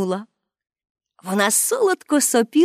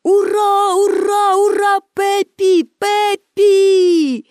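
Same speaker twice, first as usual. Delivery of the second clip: crowd cheering; 9 semitones higher, much slower than usual. A narrator's reading. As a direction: cheer like a whole circus crowd. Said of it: "І всі глядачі також закричали". (глядачі скандують ура, високо)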